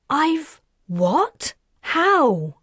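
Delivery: shocked disbelief. Acted. Karis shocked by Perseus's claim.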